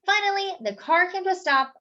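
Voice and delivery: plain reading voice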